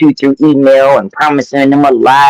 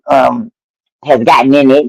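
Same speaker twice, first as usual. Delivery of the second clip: ordinary talk, nothing marked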